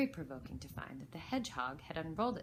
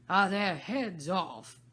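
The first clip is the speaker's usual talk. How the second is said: loud, slow demanding shout; the Queen shouts to her soldiers, loud and slow; 3 semitones higher, 12 dB louder, much slower than usual. A narrator's reading. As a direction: bellow across the garden. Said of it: "shouted the Queen".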